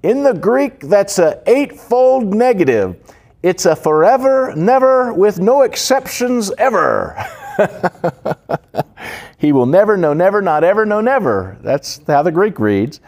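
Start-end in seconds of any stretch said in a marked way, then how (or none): 0.0-7.2 much higher, excited retelling of the pastor's words; imitating the old British pastor. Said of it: "And he used to say". 7.2-9.3 delighted laugh. Real, not acted. Laughs after the quote. 9.4-11.6 much higher, each never punched hard; emphatic never. Retold or imitated. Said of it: "And he used to say".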